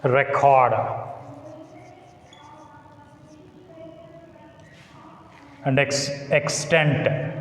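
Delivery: define, delete, debate each weighted at the end; teacher lists words with end stress; stress on second syllable. Shown as a demonstration. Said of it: "words that begin with re".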